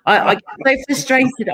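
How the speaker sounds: normal talking voice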